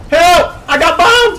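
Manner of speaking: high, loud mock panic; hammed-up cry for help. Player bombed mid-game, playfully screaming for help. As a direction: squeal for rescue as a joke.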